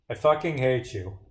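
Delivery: normal talking voice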